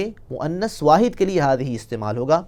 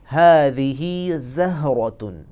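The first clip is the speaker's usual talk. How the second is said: हादिही ज़हरतुन very slowly in an Arabic accent; much slower than usual. Shown as a demonstration. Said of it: "अरबी लहजे को मद्देनज़र रखना है".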